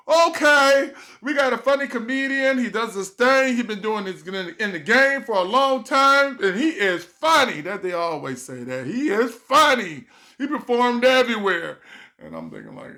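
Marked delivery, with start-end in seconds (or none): 0.1-11.8 high loud mock emcee; host hype. Host introducing comedian, high loud hype.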